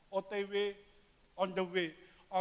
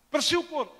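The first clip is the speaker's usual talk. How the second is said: loud pastor's command; 10 semitones higher, 7 dB louder than usual. Retold or imitated. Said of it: "Pendeta yang bilang".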